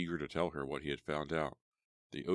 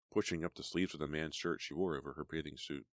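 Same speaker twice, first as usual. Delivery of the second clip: ordinary delivery, nothing marked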